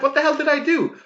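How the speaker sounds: a confused man protesting his arrest; baffled outrage. Acted. Falsely accused guy pleading with police, high baffled outrage.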